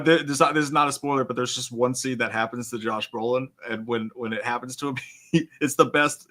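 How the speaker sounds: usual speaking voice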